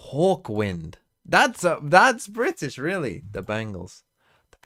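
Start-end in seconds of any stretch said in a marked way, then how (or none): 1.3-3.2 much higher and louder, eager call; excited shout of recognition. Real, not acted. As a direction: blurt the answer out thrilled. Excited answer in quiz.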